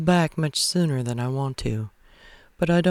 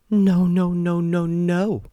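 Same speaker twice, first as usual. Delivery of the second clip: high, loud, frantic string of no's; panicked denial; 5 semitones higher, 5 dB louder, slower than usual. Acted. Anna Mae learning everyone knows, high panicked no's. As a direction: she can't let this be true. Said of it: "Anna Mae says".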